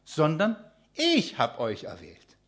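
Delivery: slow, with ich lifted and weighted; stress on ich. Shown as a demonstration. Preacher stressing ich to the congregation, slow and weighted. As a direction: hit ich in sondern ich hab euch erwählt. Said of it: "die Betonung".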